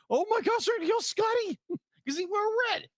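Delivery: put-on high, shocked kid voice; childlike alarm. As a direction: gasp it like a startled kid. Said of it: "I was like".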